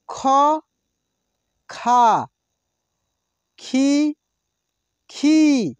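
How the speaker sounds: each syllable called out slowly and loud, with pauses; slow drill recitation. Shown as a demonstration. Teacher reciting syllables for students to repeat aloud. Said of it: "इसको मेरे साथ आप बोलिए एक एक अक्षरों को".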